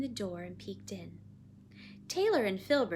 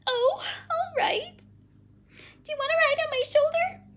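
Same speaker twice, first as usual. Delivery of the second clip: put-on very high, loud child voice; reluctant little girl; 9 dB louder than usual.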